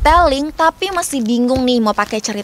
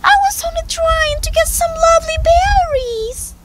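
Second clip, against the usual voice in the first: very high-pitched little mouse. A narrator's reading. Caught mouse excusing herself to the lion, high mouse voice. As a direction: explain yourself in a tiny, high voice. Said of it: "said the mouse".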